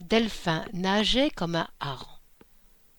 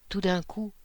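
plain reading voice

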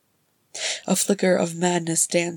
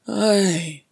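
louder, bitter agreement; bitter aye; 3 semitones higher, 6 dB louder than usual. Acted. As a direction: bite off the word in bitterness. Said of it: "Balin said bitterly".

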